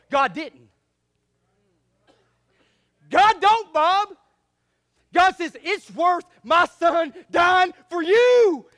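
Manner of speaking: loud building preacher cadence; rising fiery sermon; full-force preaching. Real, not acted.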